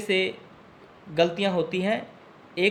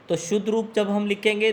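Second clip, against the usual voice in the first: usual speaking voice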